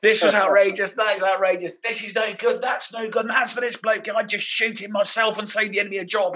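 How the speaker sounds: high, loud imitated furious rant; hammed-up fury, almost shouting; berserk rant. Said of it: "Paul just went berserk".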